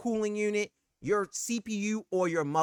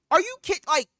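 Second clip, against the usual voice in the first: higher, louder, slowed for emphasis; furious accusation; venting at iBuyPower in retold anger; heated outburst; 8 semitones higher, 6 dB louder, slower than usual. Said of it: "I'm heated".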